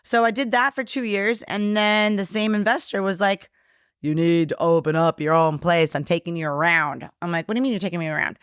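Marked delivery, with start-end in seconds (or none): none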